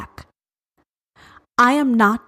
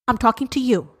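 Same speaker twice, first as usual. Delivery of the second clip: usual speaking voice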